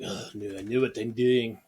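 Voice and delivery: lightly attempted, poor South African accent; bad South African accent. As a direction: have a half-hearted go at a South African accent. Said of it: "that was a horrible accent".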